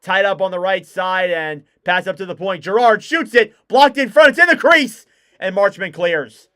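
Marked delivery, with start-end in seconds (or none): 2.6-5.0 urgent call, rising higher and louder; excited play-by-play. Real, not acted. Hockey commentator on a shot at the crease, excited. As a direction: call a dangerous chance as it builds. Live call of a scoring chance.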